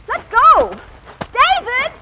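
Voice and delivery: high, loud struggling cry; calling for help. Acted. Wife calling David as husband grabs her, alarmed shout. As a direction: yell while fighting free.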